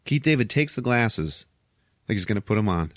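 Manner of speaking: ordinary talk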